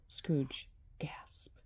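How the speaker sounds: whispered narration. A narrator's reading.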